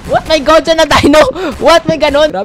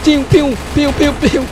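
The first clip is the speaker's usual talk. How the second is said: sound effects; 3 semitones higher than usual. Pretend. Imitating weapon sounds.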